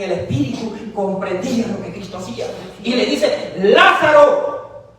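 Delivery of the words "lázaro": raised, drawn-out summons to Lazarus; a ringing preacher's shout; commanding call. Acted. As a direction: call the dead man out, high and loud. Preacher calls out Lazarus as Christ.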